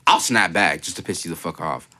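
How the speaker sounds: ordinary talk, nothing marked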